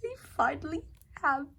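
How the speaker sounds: high, loud thrilled announcement; excited squeal. Real, not acted. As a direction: burst out with fangirl joy. Excited reveal of merch.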